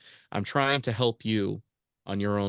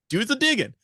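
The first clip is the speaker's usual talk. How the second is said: insistent urging; 12 semitones higher, 5 dB louder than usual. Retold or imitated. Urging a doubter to research.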